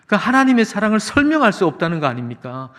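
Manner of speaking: ordinary delivery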